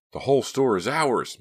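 high, loud, gleeful announcement; jubilant proclamation. A narrator's reading. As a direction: crow over the prize at full excitement. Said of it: "Mickey proclaimed jubilantly".

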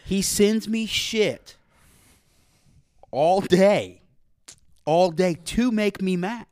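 heated, high and loud complaint. Real, not acted. Complaining that bub sends stuff all day, heated. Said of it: "Why are you getting heated?".

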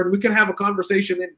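ordinary delivery

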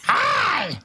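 high squawky crow saying hi; shrill crow voice. Acted. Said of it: "yelling hi in his shrill crow voice".

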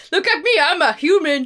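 a moth bragging that it is human. Pretend. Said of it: "He's like".

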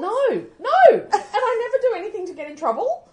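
high, loud, giggly; laughing. Real, not acted. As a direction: laugh off the joke about yourself. Laughing, mock goody-two-shoes.

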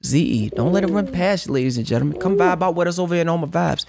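big pitch like a radio host; energy drink ad. Acted. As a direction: hype radio announcer. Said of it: "It's, it's like you've done that before".

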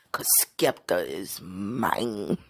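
slow, low, sultry whisper; hushed, drawn-out possessive purr. Real, not acted. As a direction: whisper it like a playful claim. Playfully claiming her celebrity crush.

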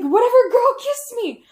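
high, loud mock delight; thrilled high-pitched boast; giddy old man. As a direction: crow it excitedly. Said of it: "and he's like".

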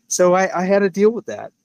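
can hardly contain it; excited outburst. Real, not acted. Telling the crowd about the surprise, excited and loud.

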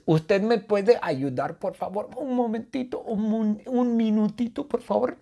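very high, wheedling granny voice; pobre abuela suplicante. Acted. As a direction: coax him in a high little-old-lady voice. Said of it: "soy una pobre abuela". (abuelita de voz aguda rogando)

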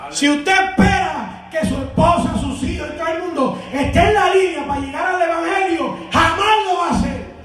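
high, insistent pulpit warning; full-force preaching. Real, not acted. Preacher warning those waiting on their family, full-force preaching. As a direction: drive the warning home as a preacher.

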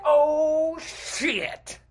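loud, high, drawn-out dismay; alarmed exclamation. Acted. Trapped guest realises the danger, loud dismayed outburst. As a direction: stretch the oh, then punch the curse. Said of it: "and you're like".

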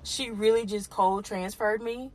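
ordinary talk

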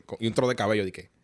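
normal talking voice

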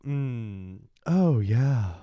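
mock-sultry hum and sigh of agreement; put-on New York sex voice; horny Cosmo drunk. Shown as a demonstration. Playing a Sex and the City woman, tipsy and sultry. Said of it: "Fucking horny Cosmo drunk".

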